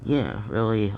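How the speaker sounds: ordinary talk, nothing marked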